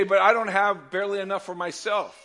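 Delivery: loud tearful protest; pleading cry. Acted. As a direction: cry out that you have nothing. Acted worried believer.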